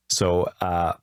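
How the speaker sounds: ordinary talk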